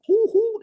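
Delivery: high playful hoots like a cheering crowd; crowd hooting. Retold or imitated. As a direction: hoot it out like fans in the balcony. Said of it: "like, hooting and hollering".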